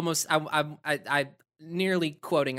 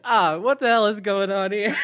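laughing, a louder bewildered mock Val; mock dazed Val; 2 semitones higher, 6 dB louder than usual. Retelling Val dripping milkshake, laughing mock bewilderment. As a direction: play the milkshake victim, stunned and laughing. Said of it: "He's just like".

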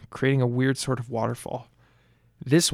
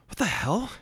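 high, startled waking blurt; startled confusion; 8 semitones higher than usual. Acted. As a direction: jolt awake confused.

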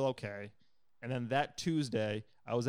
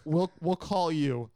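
slow, higher dismissive brush-off; mocking the staffer; 4 semitones higher, 7 dB louder, much slower than usual. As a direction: imitate a bored official. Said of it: "He's like".

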